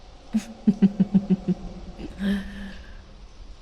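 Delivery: breathy, rippling gloating laugh; sinister giggle. Acted. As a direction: laugh wickedly, ending on a breath. Gloats over drowned victim.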